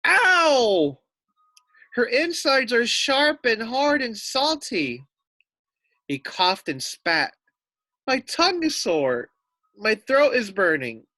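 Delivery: high, loud pained howl. Acted. The wolf after biting the fake sheep, howling in pain. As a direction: wail it like he bit something awful. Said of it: "Then he howled".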